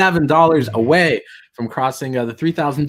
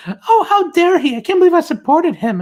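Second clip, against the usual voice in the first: high, loud falsetto of a fretting critic; hammed-up shocked hand-wringing; mock concern; 6 dB louder than usual. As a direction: parody the concerned complainer in a squeaky voice. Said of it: "all concerned".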